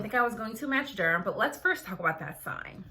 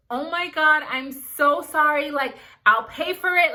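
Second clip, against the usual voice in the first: flustered, louder and higher, rushing out sorry; panicked apology; 5 semitones higher, 7 dB louder than usual. Retold or imitated. Med student to resident after dropping his scope, panicked apology. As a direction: blurt the apology in a retold panic.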